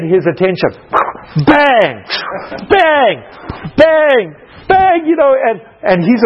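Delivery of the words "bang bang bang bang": mock banging noises. Wife slamming dishes to get her husband's attention, mock bangs.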